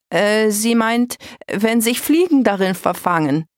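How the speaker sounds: awkwardly covering for someone; hurried cover-up. A narrator's reading. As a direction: scramble to explain away a slip. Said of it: "beeilte sich Silvania zu sagen".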